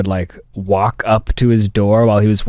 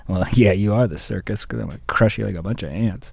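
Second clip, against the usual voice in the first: usual speaking voice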